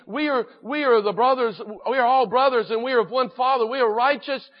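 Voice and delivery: loud, high-pitched acted protest of innocence; self-justifying. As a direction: plead their case emphatically, as the brothers. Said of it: "they were justifying themselves".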